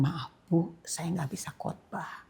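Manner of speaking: soft whispered refusal; shy whisper declining the invitation; timid whisper. Retold or imitated. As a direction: barely breathe the apology out. Retelling her timid refusal to preach.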